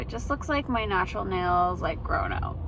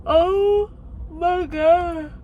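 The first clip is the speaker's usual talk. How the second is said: long, slow yawn right through the words; drawn-out yawning exclamation; 7 semitones higher, 5 dB louder, much slower than usual. Real, not acted. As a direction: let the yawn swallow the phrase. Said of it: "I can't stop yawning".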